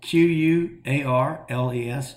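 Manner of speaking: slow letter-by-letter spelling; spelling out slowly. Shown as a demonstration. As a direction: spell each letter out clearly. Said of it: "how do you spell that".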